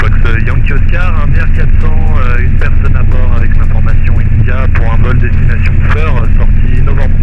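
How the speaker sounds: radio-filtered headset voice; over a pilot headset. Real, not acted. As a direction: talk like over an aviation radio.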